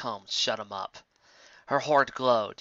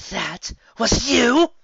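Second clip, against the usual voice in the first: high, loud, slow outraged demand; 11 semitones higher, 8 dB louder, much slower than usual. Acted. Fast Volumes demanding of Trixie, loud and high. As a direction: spit it out in shock and anger. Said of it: "Fast Volumes demanded".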